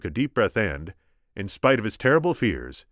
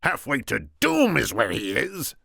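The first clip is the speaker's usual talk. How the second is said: raised, scornful character voice; scornful Sicilian; 6 semitones higher, 4 dB louder than usual. A narrator's reading. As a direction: retort grandly as the Sicilian boss. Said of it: "the Sicilian".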